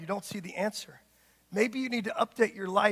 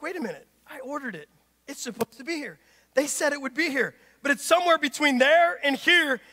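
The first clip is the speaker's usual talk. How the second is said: growing frustration; 5 semitones higher, 6 dB louder than usual. Acted. Preacher voicing a customer whose package is delayed, growing frustration. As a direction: let the irritation swell with each phrase. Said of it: "Your frustration grows".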